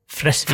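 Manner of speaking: "Fresvik" with short E and double S; short E, long S in "Fresvik"; kort E. Shown as a demonstration. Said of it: "den vanlige måten å uttale det på er dobbelt konsonantlyd". («Fresvik» med kort e og dobbel s)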